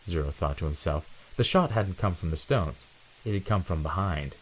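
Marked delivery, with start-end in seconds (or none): none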